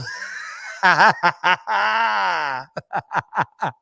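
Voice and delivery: long, high, loud burst of laughter; helpless cackling that rings out. Real, not acted. Laughing at her trying to kick a ball, uproarious.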